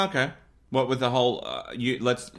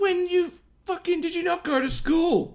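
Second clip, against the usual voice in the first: very high, squeaky exasperation; exasperated. Pretend. To co-host who misses the point, high exasperated outburst. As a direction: shoot the pitch right up in disbelief.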